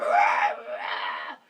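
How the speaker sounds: low strained "ah, ah" whimper; mock dog whimper. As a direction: imitate a hurt dog's cries. Imitating the dog crying.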